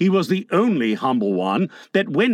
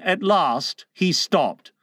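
usual speaking voice

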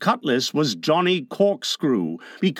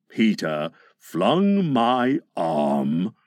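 low, slow pirate; pained recollection, drawn out deep; wincing Captain Hook; 7 semitones lower, much slower than usual. A narrator's reading. Hook recalls losing his arm, low and wincing.

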